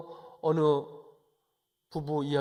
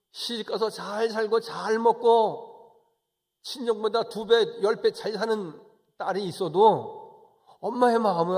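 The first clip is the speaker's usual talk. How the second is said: high, loud, impassioned illustration; fervent preacher's delivery; emphatic preaching; 7 semitones higher, 8 dB louder than usual. Real, not acted. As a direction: stress the abundance with a raised voice.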